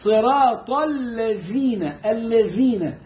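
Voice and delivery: very slow الذين with tongue tip out for ذ; correct recitation. Shown as a demonstration. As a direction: drawl صراط الذين, clear interdental ذ. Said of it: "فهنا". (تلاوة بطيئة بذال واضحة)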